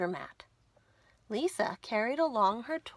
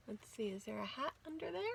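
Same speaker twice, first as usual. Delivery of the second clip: quick hushed whisper; fast secret whisper to the kids; whispered aside; 10 dB quieter, much faster than usual. Acted. Aside to child listeners.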